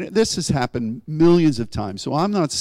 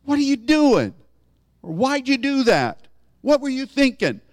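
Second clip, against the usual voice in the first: scolding parent; 11 semitones higher than usual. Retold or imitated. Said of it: "doesn't have to say".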